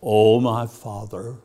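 a touch of sanctimonious prayer tone; mock pious prayer. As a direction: parody an overly solemn prayer. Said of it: "You don't just say to God".

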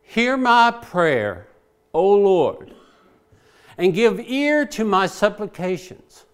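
slow, solemn scripture reading; reading the psalm. Acted. Preacher reading David's psalm to God, slow and solemn. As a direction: lift the verse up slowly. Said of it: "David starts out, he says".

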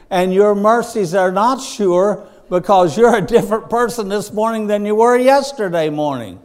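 full-force pulpit delivery; preaching. Acted. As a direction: preach it out to the congregation.

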